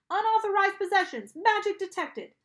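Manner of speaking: high, loud alarm voice; sustained high-pitched warning; alarm announcement. A narrator's reading. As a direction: blare it like a security alarm. Metal detector alarm announces.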